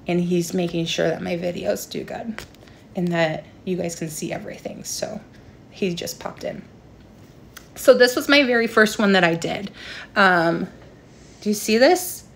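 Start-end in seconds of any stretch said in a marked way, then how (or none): 0.1-6.6 dropping to a near whisper; soft aside while someone is in the room; quiet aside. Real, not acted. Boyfriend drops in during the live.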